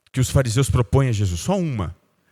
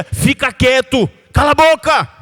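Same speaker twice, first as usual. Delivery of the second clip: higher, harsher crowd shout; angry hushing; 9 semitones higher, 5 dB louder than usual. Retold or imitated. The crowd angrily silencing the blind beggar, higher and louder. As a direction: shut him up with scorn.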